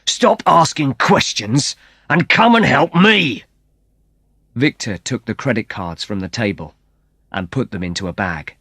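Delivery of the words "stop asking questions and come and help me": slow, high impatient order; impatient shout. Acted. Victor orders Antonio to help him, impatient and high. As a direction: snap at your brother.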